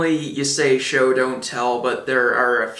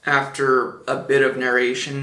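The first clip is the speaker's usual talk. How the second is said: usual speaking voice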